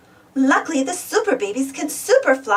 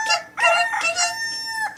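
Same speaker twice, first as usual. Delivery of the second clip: high, slow rooster crowing call; cock-a-doodle crow, stretched high; 4 dB quieter, slower than usual. A narrator's reading. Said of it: "When the rooster yelled".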